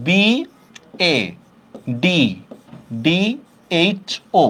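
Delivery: B A D D H O with a pause after each letter; spelling out. Shown as a demonstration. Teacher writing the word in Banglish, spelling out slowly. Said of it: "Banglish লিখলাম বাধ্য".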